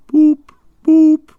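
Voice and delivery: high, loud wailing boop of a car horn; wailing motor horn. A narrator's reading. Narrator imitating the car's horn, high and wailing. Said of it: "wailed like an uneasy animal in pain".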